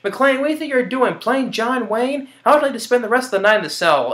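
high, sneering caricature of the captain; hammed-up captain telling McClane off; mocking the angry captain. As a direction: whine it like a snotty boss. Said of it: "And he's like".

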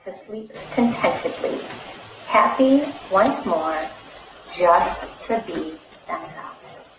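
lively read-aloud storytelling voice; animated picture-book reading; story narration. Acted. Reads picture book to children.

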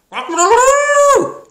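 very high, loud crowing; rooster crow. A narrator's reading. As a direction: crow at full tilt like a rooster. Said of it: "crowed Rooster".

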